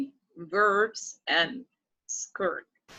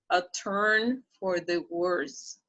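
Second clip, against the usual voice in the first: slow model reading; slower than usual. Shown as a demonstration. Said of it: "slow model reading".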